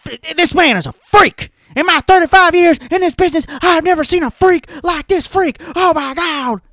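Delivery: high, loud, excited play-by-play imitation; hammed-up old-school commentator awe; Jim Ross impression. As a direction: call it like a legendary wrestling announcer losing it. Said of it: "He'd be like".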